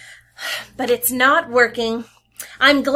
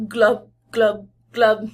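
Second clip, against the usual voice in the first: glugging sound; 2 semitones lower, slower than usual. Pretend. Sound word read aloud.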